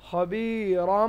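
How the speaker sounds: "খবিরম" slowly, long i and hummed final m; "খবিরম" in drawn-out recitation; iqlab with ghunnah. Shown as a demonstration. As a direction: stretch the i and hold the m of "খবিরম". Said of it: "তাহলে এখানে কি পড়বো".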